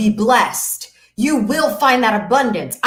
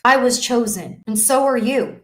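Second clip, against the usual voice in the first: normal talking voice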